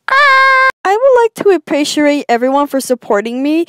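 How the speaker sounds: normal talking voice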